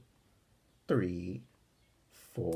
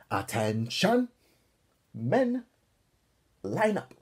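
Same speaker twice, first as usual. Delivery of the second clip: loud, slow drill-sergeant bark; barked order; 5 semitones higher, 5 dB louder, slower than usual. Acted. Counselor Rocky barking orders at the boys. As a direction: bark it at the recruits. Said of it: "barked Rocky".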